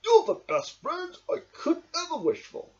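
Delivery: high, heartfelt thanks; sincere Badger; grateful Badger. A narrator's reading. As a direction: thank the friends warmly. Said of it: "beamed Badger".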